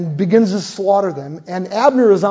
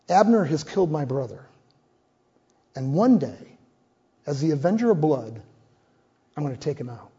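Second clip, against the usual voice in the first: inner vow, quiet; 4 semitones lower, 6 dB quieter than usual. Acted. Joab's silent vow of revenge.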